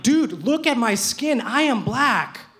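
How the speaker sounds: hammed-up outrage, every word stressed; mock angry. The narrator protesting to a stranger, loud mock outrage. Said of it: "I get mad".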